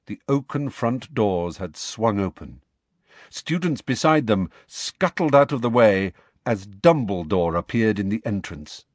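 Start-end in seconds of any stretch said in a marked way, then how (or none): none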